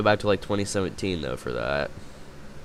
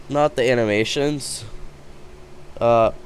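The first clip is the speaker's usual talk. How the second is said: normal talking voice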